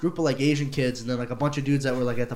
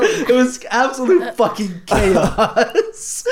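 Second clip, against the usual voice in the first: laughing while telling; 6 semitones higher, much slower than usual. Real, not acted. Recalling panic, laughing.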